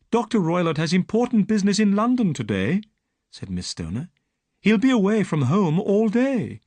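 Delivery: put-on frightened young woman telling her story; Miss Stoner.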